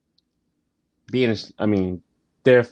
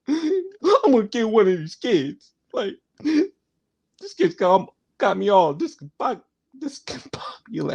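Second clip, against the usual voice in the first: Bernie talking to America; 10 semitones higher than usual. Retold or imitated. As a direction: vent to the audience like fed-up Bernie. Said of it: "telling America how".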